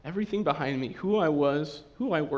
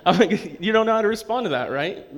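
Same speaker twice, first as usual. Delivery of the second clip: laughing through it, then rushing fast; 4 semitones higher, 7 dB louder than usual. Real, not acted. Pastor laughing about an awkward reply, then rushing on. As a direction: chuckle at the awkwardness.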